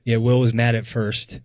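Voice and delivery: ordinary talk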